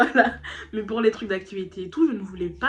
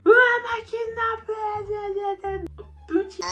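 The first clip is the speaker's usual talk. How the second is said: put-on child voice; 12 semitones higher, 6 dB louder, much slower than usual. Kid's accusation voiced.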